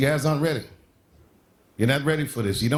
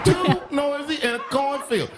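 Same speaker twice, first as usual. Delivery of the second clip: slow, high-pitched disbelief; incredulous; 10 semitones higher than usual. Real, not acted. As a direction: draw out the absurd complaint in a high voice. Incredulous at farmers' noise complaint.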